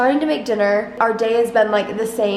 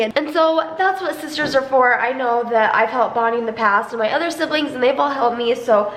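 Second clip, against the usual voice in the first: ordinary talk, nothing marked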